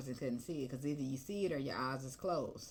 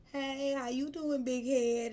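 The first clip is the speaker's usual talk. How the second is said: higher, slower flirty greeting; ex sliding back; 9 semitones higher, 5 dB louder, slower than usual. Retold or imitated. Ex circling back to flirt, slow mock charm. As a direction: sweet-talk like a returning ex. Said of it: "spin the block on me like".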